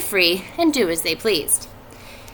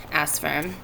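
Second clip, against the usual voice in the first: plain reading voice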